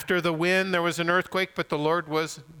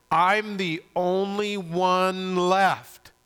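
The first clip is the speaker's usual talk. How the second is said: higher, very slow, drawn-out wail of complaint; Elijah's lament; 5 semitones higher, 4 dB louder, much slower than usual. Acted. Elijah complaining to God, in drawn-out protest. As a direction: stretch every word in loud self-pity. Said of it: "what was his reply?".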